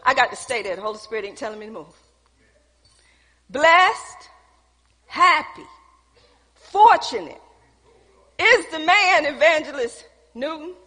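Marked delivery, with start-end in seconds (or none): none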